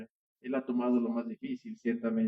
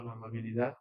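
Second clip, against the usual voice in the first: "la amabilidad" repeated much softer, rising gently; soft repetition; 3 semitones lower, 8 dB quieter than usual. Acted. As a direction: echo "la amabilidad" quietly to yourself. Preacher repeats the key phrase.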